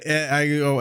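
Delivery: ordinary talk, nothing marked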